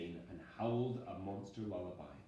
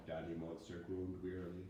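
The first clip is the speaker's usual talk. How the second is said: ordinary delivery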